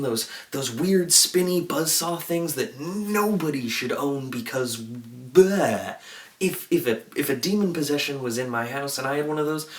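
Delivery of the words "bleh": long drawn-out bleh of revulsion; disgusted bleh. Real, not acted. Host shuddering at buzzsaws nobody should own, drawn-out disgusted bleh. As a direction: stretch the bleh out slowly in disgust.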